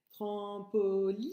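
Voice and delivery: trampoline slow, with li held and rising; "trampoline" by syllable, stretching the I; teeth-baring long I. Shown as a demonstration. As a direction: spread the lips on a long li in "trampoline". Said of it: "Je montre mes dents quand je dis".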